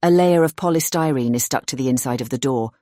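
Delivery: usual speaking voice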